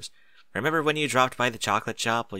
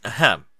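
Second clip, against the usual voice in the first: pointed ahem, clearing the throat; loud throat clearing; 4 dB louder than usual. Acted. Spike loudly clearing his throat to interrupt, pointed. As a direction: cough to cut her off. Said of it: "Spike cleared his throat, loudly".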